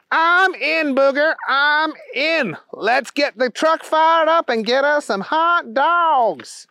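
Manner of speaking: high goofy friend agreeing to help; Keith's goofy voice. Acted. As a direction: squeal it like an eager cartoon sidekick. Keith figure in story.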